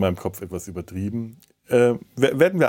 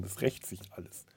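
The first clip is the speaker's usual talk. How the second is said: ordinary talk, nothing marked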